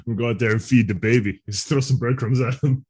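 normal talking voice

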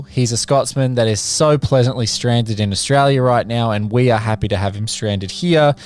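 ordinary talk, nothing marked

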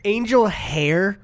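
1 mock disgust. Real, not acted. Mocking pasta name.

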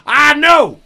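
put-on shrill drunken yell; loud yell. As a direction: bellow it at the cop. Drunk suspect bit.

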